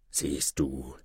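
low, quiet menacing stranger; triumphierender Zauberer. A narrator's reading. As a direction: gloat softly in a low voice. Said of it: "rief er dann". (leise, tief und bedrohlich)

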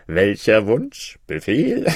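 slow, tipsy babble; drunk. A narrator's reading. Tipsy Maruf quotes the genie to king and vizier, drunk. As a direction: draw the words out drunkenly. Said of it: "in seiner Betrunkenheit".

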